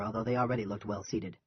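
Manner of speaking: usual speaking voice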